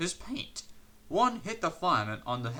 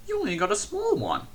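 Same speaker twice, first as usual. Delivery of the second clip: higher, fast cheeky jab; 8 semitones higher, faster than usual. A narrator's reading. Percy mocks Henry's small funnel, cheeky and fast. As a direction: needle him quickly. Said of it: "in his cheeky way".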